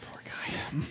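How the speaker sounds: soft slow whisper. Real, not acted. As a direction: breathe it out under your breath. Whispered mock sympathy.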